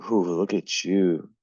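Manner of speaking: ordinary talk, nothing marked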